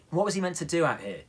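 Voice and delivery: plain reading voice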